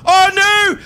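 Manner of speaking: high, loud panicked screaming. Real, not acted. Gamer caught off guard in the Nether, screaming in panic. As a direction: shriek in real panic as a creature attacks.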